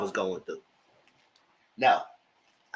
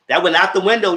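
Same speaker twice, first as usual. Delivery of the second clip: emphatic; 5 semitones higher, 10 dB louder than usual. Real, not acted. Repeated scolding punchline.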